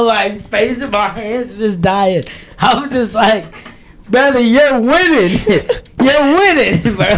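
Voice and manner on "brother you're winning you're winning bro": can barely get it out for laughing. Retold or imitated. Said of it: "I started laughing so hard".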